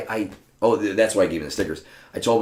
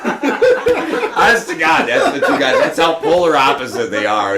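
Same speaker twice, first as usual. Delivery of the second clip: laughing through the words, slow, louder; 10 semitones higher, 9 dB louder, slower than usual. Real, not acted. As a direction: crack up while telling. Laughing while telling.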